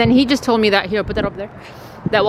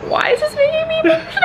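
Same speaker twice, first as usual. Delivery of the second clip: very high, tearful wail through laughter; crying with emotion; faster than usual. Real, not acted. Vlogger baffled at being so emotional, crying. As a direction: squeak it out, laughing and crying at once. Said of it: "I literally started crying".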